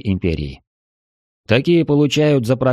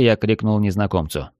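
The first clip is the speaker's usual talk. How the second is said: ordinary talk, nothing marked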